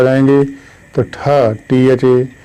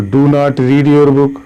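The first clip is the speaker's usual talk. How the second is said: slow example; much slower than usual. Shown as a demonstration. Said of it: "तो ... ऐसा बनाएं".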